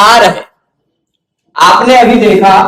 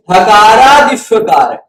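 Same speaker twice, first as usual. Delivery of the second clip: हकारादिश्वकार recited slowly, each syllable drawn out; slow sutra recitation; slower than usual. Shown as a demonstration. Teacher reading the sutra to students, slowly. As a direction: read the sutra out slowly for the class. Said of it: "यहां दिख रहा है ना".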